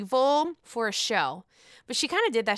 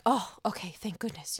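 whispered, much softer sigh of relief; mock relieved whisper; 2 semitones lower, 7 dB quieter than usual. Reacting to someone's mild symptoms, whispered relief. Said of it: "you're like".

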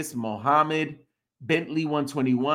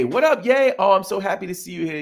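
excited greeting; 4 semitones higher, 4 dB louder, much faster than usual. Real, not acted. Greeting a favourite viewer.

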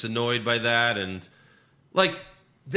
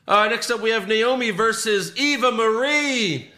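high, louder announcer voice, slow on the names; announcer read; 10 semitones higher, 4 dB louder than usual. Acted. Host introducing the match to cohosts, announcer voice. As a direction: present the next match like a ring announcer.

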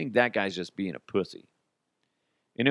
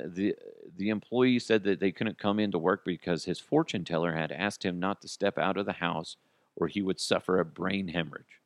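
normal talking voice

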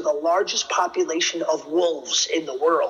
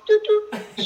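high beeping alarm noise. Retold or imitated. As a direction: beep like a security alarm. Imitating alarm sound.

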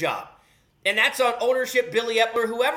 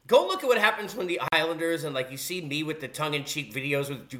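ordinary talk, nothing marked

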